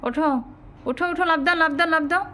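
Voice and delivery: high, eager calls to jump; coaxing a pet. Real, not acted. Calling cat to jump out. (উঁচু গলায় বিড়ালকে লাফ দিতে ডাকা)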